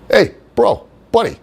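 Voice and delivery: cocky wrestler dude-bro greeting, high and loud; Johnny's bro voice. Retold or imitated. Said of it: "that's how he would talk".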